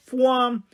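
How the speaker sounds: "Fwam" high and sharply falling, like a whoosh; the woman demonstrates the way to say "Fwam"; action-word exclamation. Acted. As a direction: fire "Fwam" off like a rocket leaving. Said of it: "We prefer to say it with this accent".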